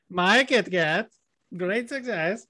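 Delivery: hammed-up foreign accent impression; Borat. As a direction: do a comic Kazakh catchphrase voice. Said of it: "Why did you sound like Borat".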